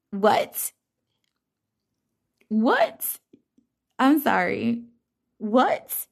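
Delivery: high, rising stunned questions; can't believe what she heard; stunned repeated what; disbelieving what. Real, not acted. Disbelief at advice.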